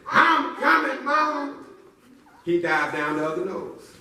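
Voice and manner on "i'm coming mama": high, eager call to mama; brave little boy. Acted. Boy shouting back to his mama. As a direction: call out like a heroic kid. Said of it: "Little boy said".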